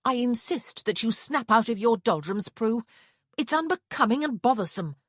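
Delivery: lightly voiced sister lecturing Prue; scolding sister. A narrator's reading. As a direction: scold her like an impatient elder sister.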